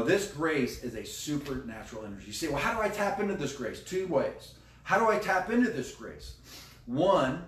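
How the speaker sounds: normal talking voice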